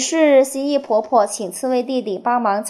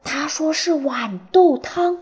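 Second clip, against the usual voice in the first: whispered secret between eavesdroppers; 悄悄低语; 5 semitones higher than usual. A narrator's reading. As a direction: whisper it as an overheard discovery. Animals secretly eavesdropping behind tree. (用气声悄悄说)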